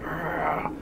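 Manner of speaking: breathy whispered bear growl, very soft; imitated growl. Recalling the growl the kids heard by the river, hushed growl. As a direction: mimic a distant animal growl under your breath. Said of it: "growling noise".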